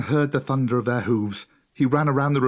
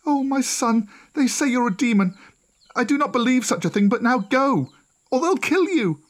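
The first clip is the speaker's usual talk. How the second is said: pleading mother; 12 semitones higher, 3 dB louder than usual. A narrator's reading. Mother urging son to flee.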